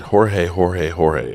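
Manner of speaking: Jorge with the J as an English H, HOR-hay; practising the name. Shown as a demonstration. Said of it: "Let me say it".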